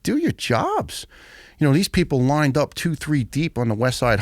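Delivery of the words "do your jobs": much higher, slow, mocking bark of an order; mock boss order. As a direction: parody the boss, drawn out and high. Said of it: "he's like".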